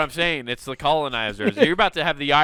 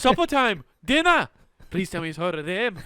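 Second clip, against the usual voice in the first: Pippin voice; 3 semitones higher than usual. Pretend. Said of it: "sounding like Pippin or Merry".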